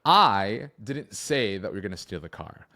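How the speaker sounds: "I" stretched and punched, the rest falling away; stress on I. Shown as a demonstration. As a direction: lean hard on the opening "I". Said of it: "the emphasis put on different words".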